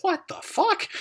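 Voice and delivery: high, incredulous what the fuck; mock disbelief. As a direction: act out baffled shock at the plot twist. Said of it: "and you're like".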